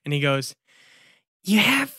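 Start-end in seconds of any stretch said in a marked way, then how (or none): 1.4-1.9 high, small character voice; Jennifer. Acted. Creature on Chip's shoulder gives advice, high put-on voice. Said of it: "Jennifer jumps up on Chip's shoulder, and he goes".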